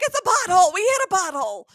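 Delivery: high, loud yell over her dad. Retold or imitated. Yelling at dad's phone call with roadside assistance, loud and high. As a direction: shout it out so the phone picks it up. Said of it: "So I'm yelling".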